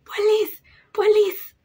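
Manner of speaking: high, loud warning shouts; imitated crowd shouting. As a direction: shout the alarm, word by word. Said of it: "people shouting".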